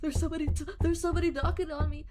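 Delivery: mock frightened high voice; imitating a spooked camper; mock scared. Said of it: "be like".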